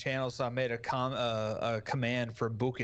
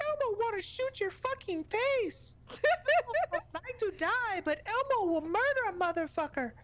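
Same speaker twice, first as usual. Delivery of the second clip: Elmo voice. Retold or imitated. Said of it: "in the Elmo voice".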